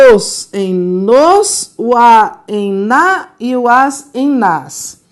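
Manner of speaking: nos, na, nas spelled out slowly; slow list of forms. Shown as a demonstration. Teacher lists pronoun changes slowly for Tereza. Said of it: "a variação pronominal transforma".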